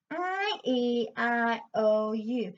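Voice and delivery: slow, spread vowels through the mask; grin vowels. Shown as a demonstration. Coach leading the class through grin vowels. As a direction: push each vowel forward into the face. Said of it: "let's try that together".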